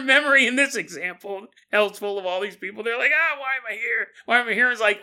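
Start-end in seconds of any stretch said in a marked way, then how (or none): 3.1-4.7 high, loud bewildered wail; mock anguished confusion; confused damned soul. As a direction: play a lost soul who can't remember. Damned souls confused in hell.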